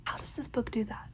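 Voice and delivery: soft hushed awe; tender whisper. Real, not acted. Reader whispering fondly about the characters. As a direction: whisper it like a secret joy.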